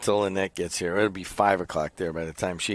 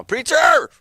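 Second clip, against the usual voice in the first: loud high call for Preacher; 11 dB louder than usual. Real, not acted. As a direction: shout the name up into the room. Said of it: "like, even right now".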